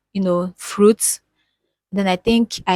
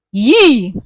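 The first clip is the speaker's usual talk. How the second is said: pained yelp; 3 semitones higher, 9 dB louder than usual. Real, not acted. Reliving abortion pain.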